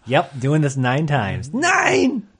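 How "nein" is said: high, drawn German cry of refusal; mock Hitler outcry. Comic playing a violated Hitler, high mock outcry.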